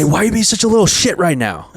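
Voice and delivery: imitating an annoyed dad; mild mock angry dad; dad yelling.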